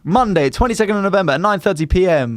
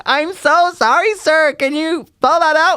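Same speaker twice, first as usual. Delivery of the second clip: American waitress; 11 semitones higher, slower than usual. Retold or imitated. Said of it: "And then she was like".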